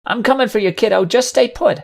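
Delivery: high-pitched, urgent rescuer's call. Acted. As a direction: call to a stranded child from afar. Rescuer calls to trapped colt.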